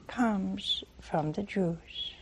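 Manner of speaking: slow whisper; hushed whisper. Acted. Preacher whispers Jesus' words to the congregation. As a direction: drop to a slow unvoiced whisper for weight.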